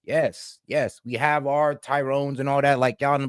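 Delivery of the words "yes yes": ordinary talk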